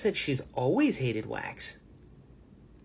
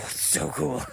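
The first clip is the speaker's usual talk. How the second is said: normal talking voice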